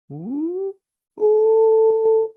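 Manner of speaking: high, rising spooky moan; ghostly ooh. Retold or imitated. Mysterious ghost noise in the car, high moan.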